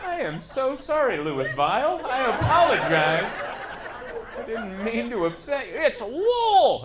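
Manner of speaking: high, loud hammed-up apology; mock apology. Comic apologizing to angry crowd, high mock grovel. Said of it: "And I'm like".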